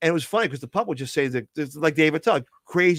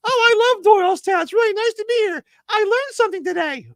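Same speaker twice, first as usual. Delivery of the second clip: much higher cute dummy voice; squeaky puppet voice; 6 dB louder than usual. Acted. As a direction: chirp it like a tiny cute puppet. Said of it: "Mister McGonagall".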